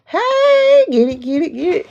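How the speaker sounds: high, loud hey, then quick cheering; sudden high-pitched hype; excited cheer. Real, not acted. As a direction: whoop it out high for a viewer. Cheers on a viewer mid-stream.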